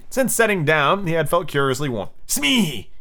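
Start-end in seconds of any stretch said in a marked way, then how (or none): 2.3-2.9 high cry to the henchman; sudden high exclamation; startled call. Acted. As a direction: yelp the name in alarm. Captain calls henchman in alarm.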